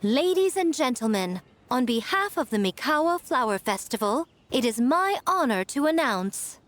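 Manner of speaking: grand ceremonial announcement rising in pitch; festival announcer. Acted. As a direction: present the guest like a herald to a crowd. Miko announces the guest.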